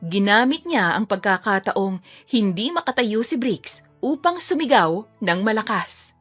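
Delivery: ordinary talk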